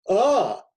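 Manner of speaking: high, loud stagey gasp; theatrical gasp. Acted. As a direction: gasp like a ham actor. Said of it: "Involuntarily, I clutched my diaphragm".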